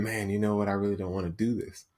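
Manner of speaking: low, soft mock grumble; quieted whiny voice. Whiny inner voice losing its hold, quiet reluctant grumble. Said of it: "The whiny voice starts to quiet down".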